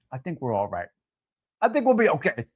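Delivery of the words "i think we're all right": much lower and softer, muttered to himself; a low muttered aside. Shown as a demonstration. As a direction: drop it way down under his breath.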